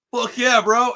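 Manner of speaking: high, loud call across a noisy party; mock cheer. [name] calling to his friend across the party, loud and high. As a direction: shout it over the music to your buddy.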